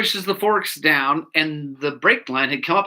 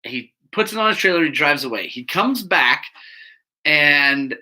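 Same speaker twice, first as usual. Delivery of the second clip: ordinary delivery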